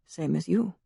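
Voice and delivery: soft, low echo of her words; echoed murmur. A narrator's reading. Drongowe echoing the Harlequin's reply, soft murmur. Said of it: "he echoed her earlier murmured words".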